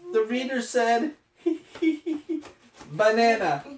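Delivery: giggling through the words; high giggly character laugh. Acted. Parent voicing Elephant, laughing at the word banana.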